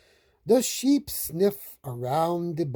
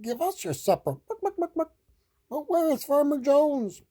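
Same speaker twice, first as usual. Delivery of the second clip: high-pitched hungry hen; high henhouse voice; chicken voice; 12 semitones higher, faster than usual. A narrator's reading. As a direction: speak as a clucking chicken. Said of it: "says the chickens".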